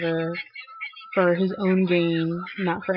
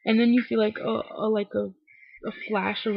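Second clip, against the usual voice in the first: normal talking voice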